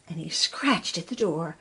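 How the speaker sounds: ordinary delivery, nothing marked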